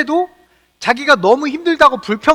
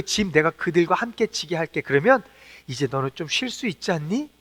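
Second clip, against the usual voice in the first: low, soft, tender reassurance; warm consoling; 5 semitones lower, 4 dB quieter than usual. Acted. God comforting an exhausted Moses, warm consoling. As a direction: comfort someone worn out, gently and quietly. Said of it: "이렇게 따뜻하게 위로해 주시는".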